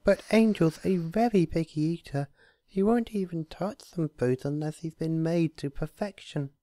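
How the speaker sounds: gently explain, unsure; shy high voice; timid Fluttershy. A narrator's reading.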